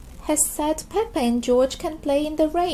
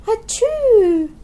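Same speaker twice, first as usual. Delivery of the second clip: loud, high acted sneeze; loud cartoon sneeze; big sneeze; 9 semitones higher, 9 dB louder than usual. George sneezes, has caught cold.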